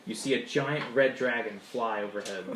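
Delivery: normal talking voice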